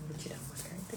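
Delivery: soft whispered aside while drawing a card. Real, not acted. Host whispering to herself as she draws the weekly card, whispered. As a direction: whisper it as you pick the card.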